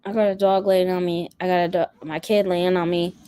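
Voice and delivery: usual speaking voice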